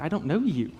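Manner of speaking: mock disbelief with a swooping YOU; comic, incredulous comeback to a near stranger; baffled retort. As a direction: play up being baffled, for laughs.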